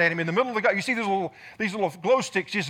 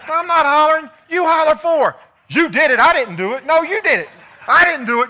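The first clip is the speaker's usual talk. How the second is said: high, loud squabbling; childish blame game; mock quarrel; 9 semitones higher, 10 dB louder than usual. Golfers bickering over who hollers.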